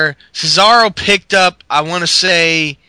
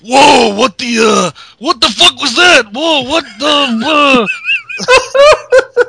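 loud, higher put-on Ryback shout; 8 semitones higher, 4 dB louder, slower than usual. Retelling Ryback baffled in the ring, loud put-on voice. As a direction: belt it out like the big man. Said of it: "I should do it in his voice".

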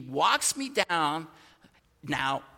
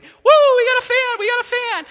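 much higher, much louder whooping cheer; imitating a celebrating family, shrieking with joy; excited cheer; 13 dB louder than usual. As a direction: cheer like a whole room just got their wish. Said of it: "they're celebrating".

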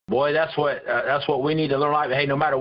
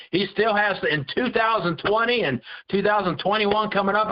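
higher, fervent preaching; a rising pulpit build; 5 semitones higher than usual. Acted. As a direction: lift the congregation with conviction.

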